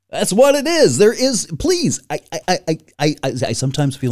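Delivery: high-pitched excited insistence; worked up. Real, not acted. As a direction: pitch jumps up, stumbling with agitation. Worked up after the rant.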